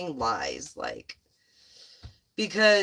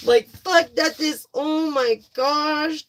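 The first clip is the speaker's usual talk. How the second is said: excited outburst; 7 semitones higher, 6 dB louder, slower than usual. Real, not acted. Laughing at durable cards.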